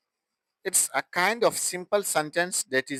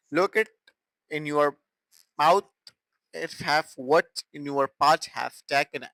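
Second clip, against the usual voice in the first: ordinary talk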